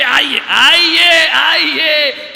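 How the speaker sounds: drawn-out slow, high welcome; mock welcome. Seth welcoming his friend Yamraj, slow drawn-out greeting. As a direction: stretch each welcome out grandly.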